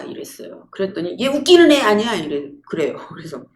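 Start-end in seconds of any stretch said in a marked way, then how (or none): 1.2-2.1 high, loud mock indignation; offended comeback. Woman teased at a gathering answers back, offended comeback. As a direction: imitate someone scoffing in offence, pitched up.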